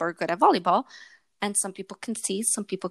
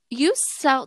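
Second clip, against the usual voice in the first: ordinary talk, nothing marked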